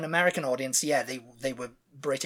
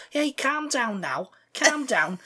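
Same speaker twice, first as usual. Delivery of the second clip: mock Scouse accent; 9 semitones higher, 4 dB louder than usual. Mocking how people fake the Beatles, broad Scouse. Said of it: "Like, they do, do".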